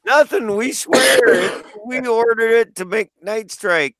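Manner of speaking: high laughing denial; mock innocent. Joining the joke, high mock innocent denial. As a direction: play innocent through laughter.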